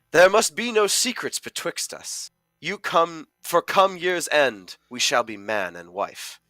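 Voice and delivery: a stage-verse Johnny proclaiming to his fiancée; earnest high theatrical verse delivery. Acted. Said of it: "So I am Johnny".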